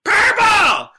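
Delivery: high piercing shouted heckle; heckler scream. Retold or imitated. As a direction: yell it out like a rowdy heckler in the crowd. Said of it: "screams out".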